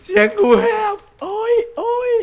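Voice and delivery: very high, cracked wailing; hoarse. Real, not acted. Gamer complaining their voice is hoarse, cracked high wail. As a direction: wail it out with the voice breaking.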